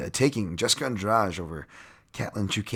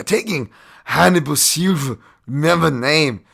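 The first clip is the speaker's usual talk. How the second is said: hype; 7 semitones higher, 9 dB louder than usual. Real, not acted. Hyping a fighter.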